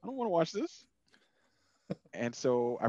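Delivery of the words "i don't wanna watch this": high, whiny complaint of a bored viewer; fed-up moviegoer whining halfway through; disgusted whine. Retold or imitated. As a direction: whine it like you can't sit through another minute. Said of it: "I was like".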